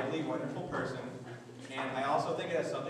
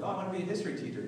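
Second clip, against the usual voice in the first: fast quote; 6 semitones higher, much faster than usual. Retold or imitated. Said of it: "I'm like".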